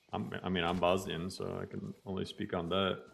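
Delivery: ordinary delivery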